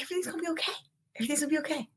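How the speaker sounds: soft, high reassurance; soothing. Real, not acted. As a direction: gently comfort the listener. Comforting listeners.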